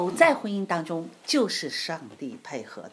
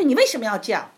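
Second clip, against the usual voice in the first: loud, fast, higher mock scolding; quick, raised imitation of the instructor's rebuke; 7 semitones higher, 9 dB louder, faster than usual. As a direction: snap at the student like a strict instructor. Quoting the scolding driving instructor.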